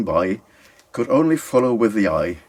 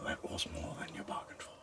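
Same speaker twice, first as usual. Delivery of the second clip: quick, breathless whisper; whispered aside; 21 dB quieter, faster than usual. Real, not acted. Reader whispers a quick aside after finishing, fast whisper. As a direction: hush it out fast as a private joke.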